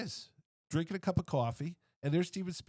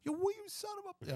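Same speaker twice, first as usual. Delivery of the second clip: much higher, spluttering mock outrage; outraged woman; 5 dB quieter than usual. Woman hears her picture was skipped, high outraged splutter. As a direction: sputter it as the offended woman. She starts going off.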